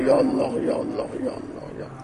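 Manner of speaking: یا الله whispered and fading to almost nothing; whispered invocation. Real, not acted. Preacher whispering the name of God in prayer, hushed. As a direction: breathe یا الله on a whisper, dying away.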